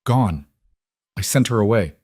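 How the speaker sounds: ordinary delivery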